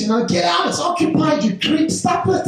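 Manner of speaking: hammed-up high indignant lady; mock woman's voice. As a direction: shriek it up high at the creep at the door. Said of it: "and she says".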